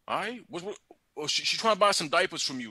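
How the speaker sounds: ordinary delivery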